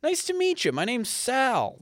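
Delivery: hammed-up high chummy New Yorker; won-over New Yorker. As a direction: play the local warming up all at once. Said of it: "they're like".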